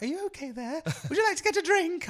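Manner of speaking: high lightly voiced caring old lady; sweet old lady. Kind old customer checking on the call worker, high sweet old lady. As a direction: coo it like a kind granny. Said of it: "this really nice old dear was like".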